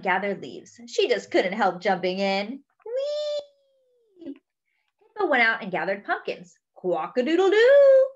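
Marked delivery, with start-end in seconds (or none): 2.8-4.4 very high, long whee; gleeful squeal. Acted. Duck jumps into the gathered leaves, gleeful squeal. 6.8-8.1 high, loud, long crow; rooster crow. Acted. Duck sitting on a pumpkin, crowing like a rooster. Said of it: "cried Duck".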